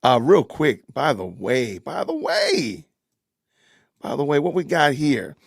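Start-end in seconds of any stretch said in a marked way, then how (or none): 1.8-2.9 by the way stretched very slow, pitch higher; slow, sing-song by the way; drawn-out by the way. Shown as a demonstration. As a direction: drag out by the way lazily, lifting it up. Repeating the phrase, drawn out.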